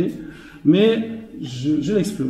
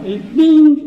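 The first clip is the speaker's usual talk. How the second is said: a sudden high, loud "bing" for the knock; high, loud playful "bing"; mock crash "bing"; 10 semitones higher, 7 dB louder than usual. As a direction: blurt out the bang like a comic sound effect. Buffet struck by accident.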